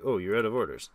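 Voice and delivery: ordinary delivery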